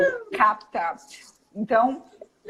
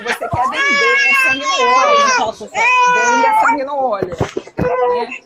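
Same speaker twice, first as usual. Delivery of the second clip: much higher and louder, fierce push; punchy fired-up; 13 semitones higher, 7 dB louder than usual. Shown as a demonstration. Speaker firing up sellers, punchy and loud. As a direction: shout it with fire in the eyes. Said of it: "você tem que ter punch".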